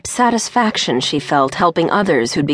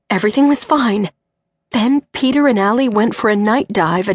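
a touch higher, tense retelling; lightly voiced distraught mother; 5 semitones higher than usual. Mother telling Cindy of the lost bride, distraught. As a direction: tell the disaster, breaking on never. Dana's voice in story.

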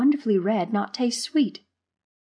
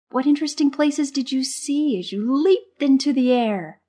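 high voice soaring upward; excited wonder; 7 semitones higher than usual. A narrator's reading. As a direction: get carried away by your imagination. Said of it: "Excited by his own imagination".

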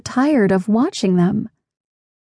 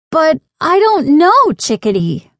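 high, loud protest, falling off at the end; acted wail of protest; 8 semitones higher, 4 dB louder than usual. A narrator's reading. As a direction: object emphatically, pitch jumping up. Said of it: "Lainey protested".

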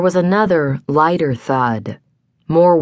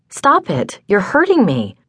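high, distressed woman's cry; pained protest; 7 semitones higher than usual. A narrator's reading. Woman to the man who is hurting her, high pained protest. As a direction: protest in pain, pitch jumping up. Woman being hurt in a quarrel.

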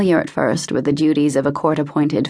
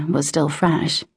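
ordinary delivery

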